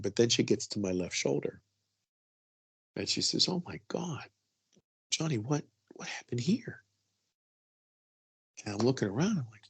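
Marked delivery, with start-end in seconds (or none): 3.0-6.8 soft, hushed alarm; a quiet gasp of worry over the wound; hushed worried mother. Retold or imitated. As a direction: breathe it out like a shocked mom. Mother whispering over the bruise.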